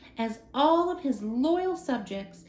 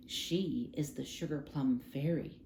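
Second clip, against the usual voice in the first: soft; 7 semitones lower, 8 dB quieter than usual. A narrator's reading. Prince introduces the fairy.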